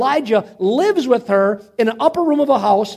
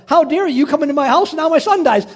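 higher, louder retold accusation; indignant blame; 7 semitones higher, 5 dB louder than usual. Widow blaming the prophet for her son's death, indignant. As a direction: act out her reproach, pitched up. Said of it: "she basically blames Elijah".

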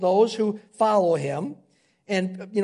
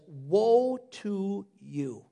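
grave pronouncement; 2 semitones lower, 5 dB quieter, much slower than usual. Acted. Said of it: "he looks at them and he says".

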